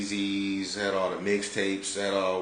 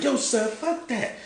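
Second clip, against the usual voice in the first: slow, high mock of a mad friend; high-pitched imitation. Storyteller mimicking homeboy going straight at it, high-pitched. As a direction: imitate him drawn out and way up high. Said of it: "high pitch".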